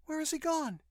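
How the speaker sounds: put-on high-pitched young woman's question; Judith calling. A narrator's reading. Judith asks where the pilot went, calling. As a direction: call it out in a high female voice. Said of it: "Judith called".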